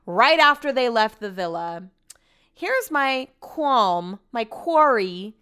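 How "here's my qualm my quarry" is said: slow, stretched, searching for the word; drawn-out wording. Real, not acted. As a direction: drag out qualm as if tasting it. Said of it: "I don't know if I'm using those words correctly".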